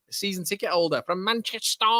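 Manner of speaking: high mock Mancunian; Mancunian accent. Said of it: "that is what you call a Mancunian accent".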